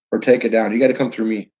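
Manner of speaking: ordinary delivery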